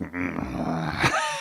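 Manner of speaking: low mock zombie groan; imitated zombie groan. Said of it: "it's dead silent and just".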